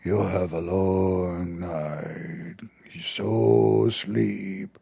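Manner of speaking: low and very slow luring devil voice; Shaitan's voice. Retold or imitated. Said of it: "and he tell you".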